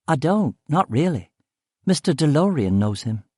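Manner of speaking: lightly voiced woman character. Woman answering narrator about Sir Harry, lightly lilting and high. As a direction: lightly raise the pitch for her.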